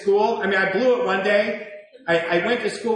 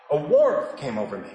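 usual speaking voice

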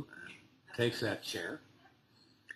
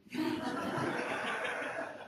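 laugh; 6 dB quieter than usual. Real, not acted. Speaker laughs at own question.

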